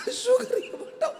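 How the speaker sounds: high, laughing mock wife voice; imitating the wife. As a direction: play the teary hostess for laughs. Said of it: "అంటుంది మళ్ళీ".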